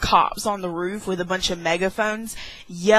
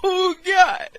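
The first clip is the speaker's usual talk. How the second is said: high, crying "Oh God"; half-sob the words; mock crying; 11 semitones higher, 3 dB louder than usual. Scared girl taking little steps, mock-crying high. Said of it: "and I'm just like".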